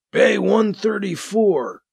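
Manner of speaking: slow, faint dying voice; weak voice. Acted. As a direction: push out the words with little strength. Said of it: "Thomas says in a weak voice".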